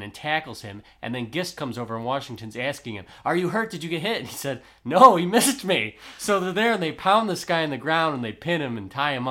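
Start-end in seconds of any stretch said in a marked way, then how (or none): 3.2-4.2 higher, louder retold alarm; urgent concern. Acted check after a gunshot. 4.8-6.0 loud, high, drawn-out retold exclamation; agitated reply. Acted reply after being shot at.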